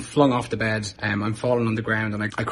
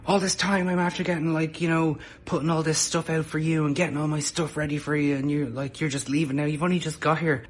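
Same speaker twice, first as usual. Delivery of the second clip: mock annoyed rant; 6 semitones higher than usual. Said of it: "He got really fucking annoyed".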